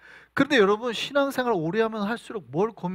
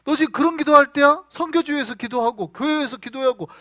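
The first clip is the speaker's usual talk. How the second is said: raised, higher-pitched rebuke; hammed-up exasperated telling-off; acted scolding; 5 semitones higher, 5 dB louder than usual. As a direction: play God sternly telling off a mature believer. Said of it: "막 혼낸단 말이죠".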